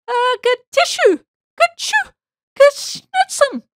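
high, loud storybook sneezing; mock sneezes. A narrator's reading. Gator with chalk dust in his nose, mock sneezing. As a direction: explode into three hammed-up sneezes. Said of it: "sneezed the gator".

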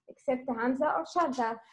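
ordinary talk, nothing marked

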